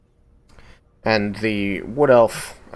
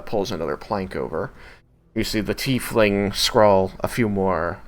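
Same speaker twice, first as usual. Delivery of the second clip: ordinary talk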